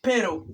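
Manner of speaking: "Pero" with a tapped R; correct R. Shown as a demonstration. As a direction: say "Pero" with R, not L. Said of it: "sino pero".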